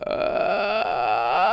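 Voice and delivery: cracking high scream sound; loud flipped falsetto cry; strained falsetto cry. Shown as a demonstration. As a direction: let the voice flip into falsetto and crack. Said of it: "So I'll demonstrate".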